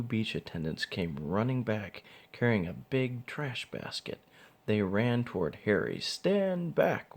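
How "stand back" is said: high, firm command; stern order. A narrator's reading. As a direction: order the crowd back. Attendant orders the crowd.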